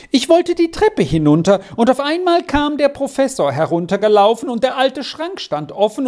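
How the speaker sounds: put-on high, agitated child's account; tearful telling. A narrator's reading. Girl explains how she got locked in, tearful and high. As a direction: pour it out tearfully, pitched high. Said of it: "schluchzte Squirrel".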